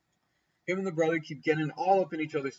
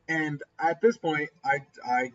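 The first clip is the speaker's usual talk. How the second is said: ordinary delivery, nothing marked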